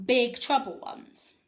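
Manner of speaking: usual speaking voice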